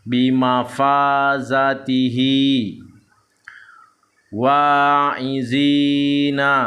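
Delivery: slow, drawn-out Arabic syllables on an even tone; measured Quran-reading demonstration; Iqra recitation drill. Shown as a demonstration. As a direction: chant each syllable long and steady. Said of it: "harus dihati-hati ketika membaca".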